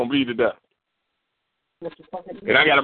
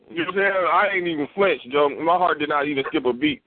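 normal talking voice

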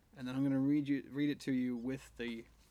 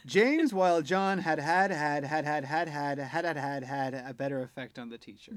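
James, while John had had had read as one run-on without phrasing breaks; no punctuation; 5 dB louder than usual. Shown as a demonstration. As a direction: rattle off every had with no pauses. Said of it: "Do you need that one one more time?".

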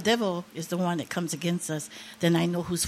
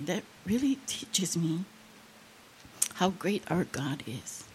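hushed, breathy aside; awed whisper; 2 semitones lower, 6 dB quieter than usual. Real, not acted. Grandmother marvels at her granddaughter in a whisper. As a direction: let it drop to a whisper of awe.

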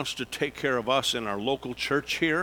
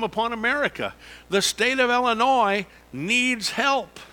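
preaching at full force; 7 semitones higher, 5 dB louder than usual. Acted.

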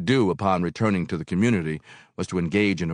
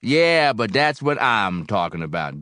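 mildly mournful, falling after a loud "Yeah"; 4 semitones higher, 7 dB louder than usual. A narrator's reading. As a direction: start firm, then sink into a touch of sorrow. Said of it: "Barrel House said mournfully".

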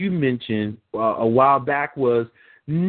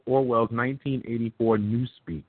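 ordinary talk, nothing marked

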